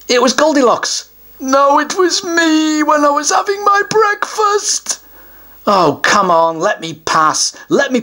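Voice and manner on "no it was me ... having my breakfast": higher, smug troll voice; a troll pleased with his trick answer. Acted. Troll teases the child with a trick answer, smug.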